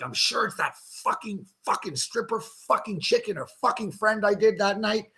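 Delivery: put-on half-whispered panic while blaming girls in his head; hushed inner voice.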